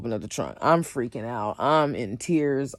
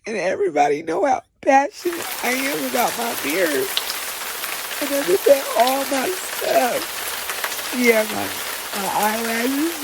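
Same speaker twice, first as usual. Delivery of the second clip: higher and slow, voice close to tears; teary; 10 semitones higher than usual. Real, not acted. Listing the stock her friend took, in a teary voice. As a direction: let the hurt crack through. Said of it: "sitting there crying".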